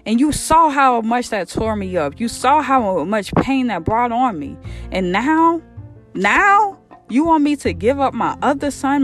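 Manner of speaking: hammed-up hurt father; acted anguished protest. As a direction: plead in disbelief, rising into the question. Grieving father refuses to give son.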